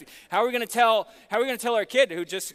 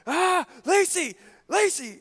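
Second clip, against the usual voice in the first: high, loud frantic call for help; panicked call; 12 semitones higher, 6 dB louder, slower than usual. Retold or imitated. Husband panicking at the sink, calling his wife, high frantic yell. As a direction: yell her name in a panic.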